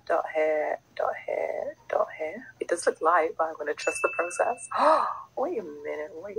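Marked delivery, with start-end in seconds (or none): 0.1-2.5 whispering. Real, not acted. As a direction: breathe the words out. Dabbing product on.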